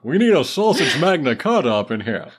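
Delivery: high, loud put-on movie character voice; loud, high-pitched comic character bit; Cameron Fry voice. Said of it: "my Cameron Fry voice is slowly turning into".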